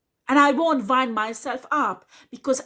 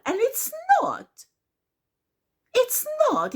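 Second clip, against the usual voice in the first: "it's not" leaps far higher, falling hard; emphatic high denial; 13 semitones higher than usual. Real, not acted. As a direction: shoot up high and drop on "not".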